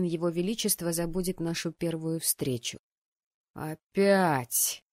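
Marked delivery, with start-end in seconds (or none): 3.5-4.8 drawn groan of dismay; weary moaned exclamation. A narrator's reading. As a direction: groan it out, fed up. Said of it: "простонал парень".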